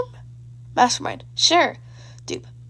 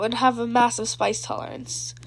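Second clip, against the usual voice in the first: ordinary delivery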